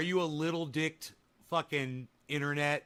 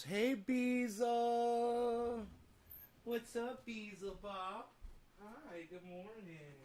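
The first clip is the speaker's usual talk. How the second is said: soft, higher cooing to the pets; 5 semitones higher, 8 dB quieter, slower than usual. Real, not acted. Greeting the pets in the morning, soft cooing baby talk. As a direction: coo a sweet hello to the little ones.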